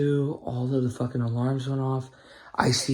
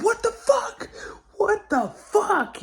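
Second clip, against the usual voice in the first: very high, stretched-out mock scream; retold screaming; slower than usual. Storyteller imitating himself at the fire, screaming high. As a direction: squeal it in drawn-out alarm. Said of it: "And I'm fucking screaming like".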